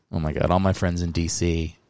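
usual speaking voice

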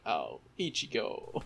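soft, slow, pitying sigh; a soft pitying murmur at a sad scene; tender sigh. Real, not acted. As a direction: say his name like you want to hug him. Sympathetic sigh at Ichigo.

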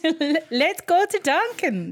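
a jokey stab at an Irish voice; lightly put-on Irish accent; mock Irish accent. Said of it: "That's, that's not an Irish accent".

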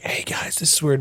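unvoiced stealthy whisper; breathy secret whisper. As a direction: hush it to your friends. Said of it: "And be like".

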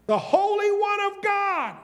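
ringing preacher shout; exclaimed proclamation. Real, not acted. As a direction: shout the title out.

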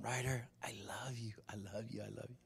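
very soft, hushed murmur of affection; sweet nothings whispered close to a beloved dog; tender whisper. Acted. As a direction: whisper it low, fading with each repeat. Intimate words to his dog.

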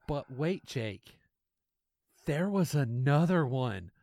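usual speaking voice

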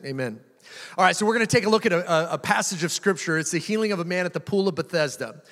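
normal talking voice

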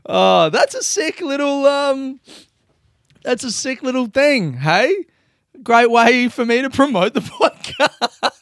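excited. Real, not acted.

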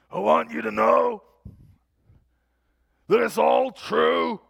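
put-on high, very loud, thunderous old biker; booming old preacher. Rugged old believer tells the congregation, booming shout. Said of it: "he's got a voice that you just can't believe".

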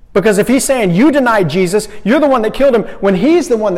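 high, loud, driving preaching; preaching at full force. Acted. Preacher building the charge against the crowd, loud. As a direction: press the point hard to the room.

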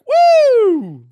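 very high loud whoop of glee; triumphant whoop. Acted. Rich guy whoops loudly over his stock market win. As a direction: cheer like you just got rich. Guy makes two billion.

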